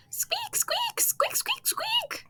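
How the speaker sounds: very high, breathy squeaking; hamster squeaks. Acted. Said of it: "he shrieks again and again".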